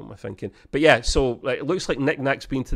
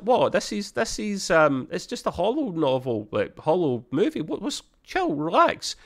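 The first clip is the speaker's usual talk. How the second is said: high, breezy mock shrug of a voice; mock dismissive; 7 semitones higher than usual. Rutherford shrugs off upset Tendi in a high mock-casual voice. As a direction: brush it off, pitched up and light. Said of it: "And he's like".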